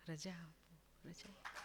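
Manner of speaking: the last refrain breathed out in a whisper; whispered ending. Acted. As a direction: let the song die away in a whisper. Song fades to whispered end. (અંતે ધીમા ફફડાટમાં ગવાયેલું)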